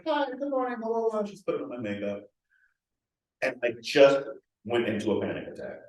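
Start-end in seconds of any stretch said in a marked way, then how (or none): 0.1-1.2 forced cheery greeting. Retold or imitated. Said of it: "and I'm like".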